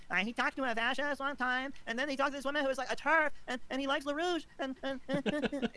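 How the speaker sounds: mock the critic as a whiny nitpicker; mocking impression of critic. [name] mocking the critic in a high whiny voice. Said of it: "that impression that you gave".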